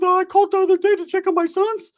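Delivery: high nagging girlfriend impression; high mock girl voice. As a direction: put on a squeaky gossiping-girl voice. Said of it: "She's like".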